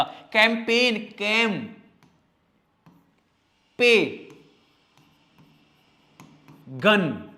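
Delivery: कैंपेन broken into कैम, पे, गन, very slow; spelling by syllables. Shown as a demonstration. Teacher spells for pupils, sounding out syllables slowly. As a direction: drag out each syllable of कैंपेन. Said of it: "देखो इसको कैसे लिखेंगे".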